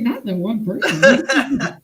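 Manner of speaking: quoting herself while laughing. Retold or imitated. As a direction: laugh through the quote. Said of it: "I was like".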